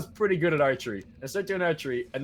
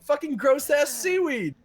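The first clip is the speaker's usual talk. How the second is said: high, slow mock announcer voice; camp counselor voice; 11 semitones higher, 4 dB louder than usual. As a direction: ham up a cheesy camp leader.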